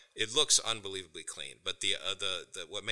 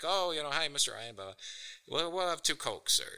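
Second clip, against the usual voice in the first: lightly imitated kid, pitched up; a hint of a child's higher voice; kid ordering; 7 semitones higher than usual. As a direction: put on a slightly higher voice for a polite kid. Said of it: "the kid's like".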